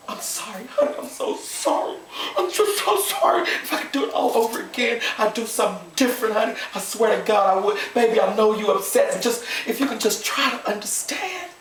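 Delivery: high, whimpering plea for forgiveness; sobbing apology. Acted. Wife apologising to devastated husband, tearfully pleading.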